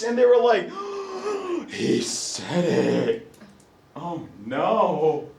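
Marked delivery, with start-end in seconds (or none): 0.7-1.6 big audible intake of breath; mock shocked gasp. Students hear the teacher say a taboo word, mock gasp. 1.7-5.3 low, drawn-out hushed horror; mock scandalised. Students reacting to teacher saying a taboo word, mock scandalised.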